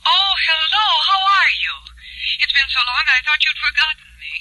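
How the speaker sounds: high, loud and thrilled to hear him; light up at his call; gushing welcome to an old flame; delighted surprise. Acted. Old flame calls unexpectedly.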